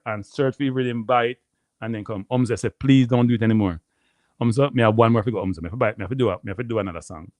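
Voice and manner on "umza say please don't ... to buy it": ordinary talk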